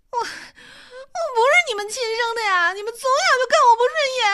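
high, loud tearful wail, rushing out; crying. Acted. Daughter cries to her parents she feels unloved, high tearful wail. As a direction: sob the complaint out in a rush. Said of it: "秋慧伤心地哭了起来".

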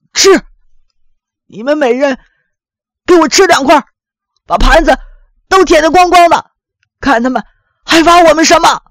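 put-on old father barking orders, loud and high; angry commands. A narrator's reading. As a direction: bark it out in bursts, defiant. Angry father orders family to eat. (老父亲高声怒气冲冲地下命令)

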